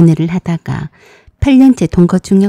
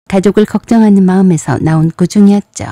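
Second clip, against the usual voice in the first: ordinary delivery, nothing marked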